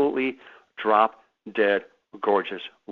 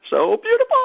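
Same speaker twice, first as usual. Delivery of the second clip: gushing falsetto; 6 dB louder than usual. Retold or imitated. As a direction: squeal it in a very high, louder falsetto. Gushing quote to Miss America.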